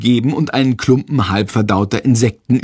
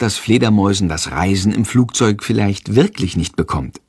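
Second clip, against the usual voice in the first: ordinary delivery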